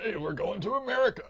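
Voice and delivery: high, laughing comic impression; Seth Rogen. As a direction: exclaim it, breaking into a big loud laugh. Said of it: "Seth Rogen would play Fabricio".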